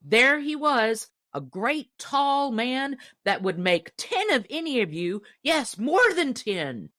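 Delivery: excited insistence. Acted. Hunter defying the loafers at the store, loud insistence. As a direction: make them believe you.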